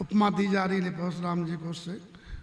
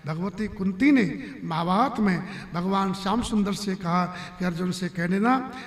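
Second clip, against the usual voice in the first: normal talking voice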